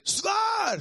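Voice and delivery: high, loud cry of disbelief; disbelieving shout. Retold or imitated. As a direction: yell it out as if you can't believe it. Friends react in disbelief. (högt, vantroget utrop)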